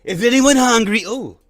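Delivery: loud, high hammed-up Trump; over-the-top Trump impression; mock Trump voice. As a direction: boom it out like a rally speaker. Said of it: "Start walking around like".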